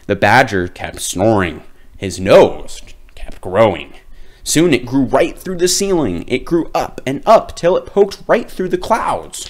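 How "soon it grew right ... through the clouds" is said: rising excitement. A narrator's reading. Nose growing upward.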